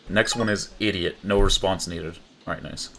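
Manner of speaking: ordinary delivery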